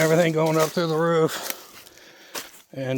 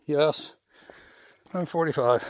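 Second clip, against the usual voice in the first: ordinary talk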